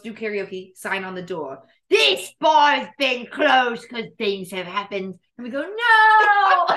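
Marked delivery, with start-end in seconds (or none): 1.9-5.1 slow, mock-official announcement of a sign; drawn-out notice-reading voice; sign read aloud. Reads closure sign in put-on voice. 5.6-6.7 high, loud drawn-out cry of dismay; hammed-up howl of disappointment; dramatic wail. Retelling dismay at closed bar.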